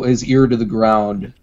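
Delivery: usual speaking voice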